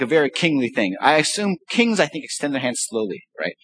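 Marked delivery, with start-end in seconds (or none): none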